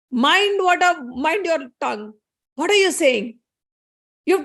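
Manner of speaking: high-pitched indignant scolding; angry retort. Acted. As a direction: act out a goddess cursing back. Gauri quarrels back, curses Lakshmi.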